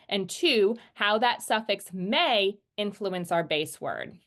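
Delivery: ordinary delivery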